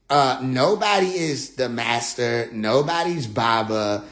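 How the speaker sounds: ordinary talk, nothing marked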